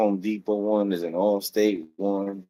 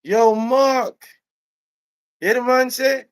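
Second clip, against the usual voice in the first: very high, loud Jamaican holler; Jamaican accent; 12 semitones higher, 5 dB louder than usual. Retold or imitated. Employee calling his coworker Mark over, loud Jamaican outrage. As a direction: call out scandalized in thick Jamaican patois. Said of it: "he's Jamaican".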